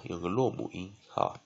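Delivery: usual speaking voice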